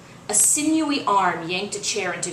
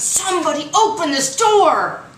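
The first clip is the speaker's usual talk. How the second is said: much higher, loud pleading yell; desperate shout; 10 semitones higher, 7 dB louder than usual. Acted. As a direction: pound the door and yell. Coach rattling locked door.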